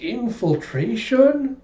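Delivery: put-on high, drawn-out childlike question; awed echo. Small girl echoes the new game's name, high awe. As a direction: stretch the word in kid-like wonder. Anya echoes him in wonder.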